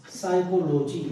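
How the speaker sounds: साइकोलॉजी said sai-ko-lo-ji, no p at the start; teacher showing the silent p. Shown as a demonstration. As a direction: start साइकोलॉजी straight on the s sound. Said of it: "केवल साइकोलॉजी बोलेंगे".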